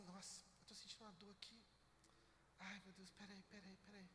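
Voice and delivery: soft, pained moaning voice of a hypochondriac; lightly mimicked suffering; mock complaining parent. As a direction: whine it quietly like someone always hurting. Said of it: "a mãe ou o pai são assim".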